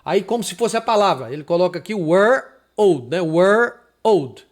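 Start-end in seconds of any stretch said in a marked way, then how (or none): 1.9-3.1 put-on "were", pause, "old"; separated syllables. Shown as a demonstration. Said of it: "aí fica separado". 3.2-4.4 put-on "Were", pause, "old"; firm split demo of "Were old"; separated syllables. Shown as a demonstration. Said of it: "aí fica separado".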